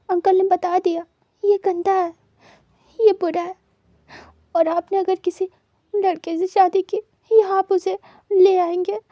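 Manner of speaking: put-on hurt boy arguing while crying; tearful. A narrator's reading. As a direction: still choked up, pushing back through tears. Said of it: "वो wing को रोते हुए नहीं देख पा रहे थे".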